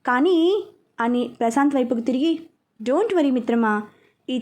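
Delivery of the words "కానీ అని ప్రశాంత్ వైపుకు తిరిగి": plain reading voice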